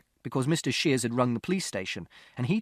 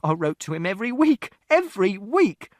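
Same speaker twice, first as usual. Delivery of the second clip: insistent shout; 8 semitones higher, 6 dB louder than usual. A narrator's reading. Mother defending herself to father, insistent shout. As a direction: hammer home the repeat. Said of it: "And Mother shouted".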